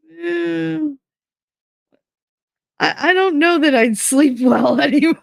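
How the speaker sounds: high, sing-song doubtful teasing; sarcastic doubt. Retold or imitated. Mocking his dreams of life together, high sing-song doubt. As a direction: tease him with mock hesitation.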